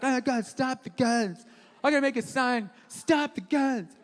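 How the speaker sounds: drawn-out mocking protester voice; whiny mock liberal. Comic mocking liberals who protest guns, drawn-out mock plea. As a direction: plead slowly, making fun of hand-wringers. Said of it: "But then liberals like".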